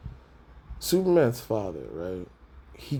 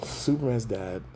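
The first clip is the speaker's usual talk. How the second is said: ordinary talk, nothing marked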